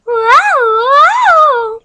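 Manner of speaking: loud very high screech; weird scream. Acted. Matthew walking up, weird loud high scream. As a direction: screech it bizarrely. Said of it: "screamed weirdly".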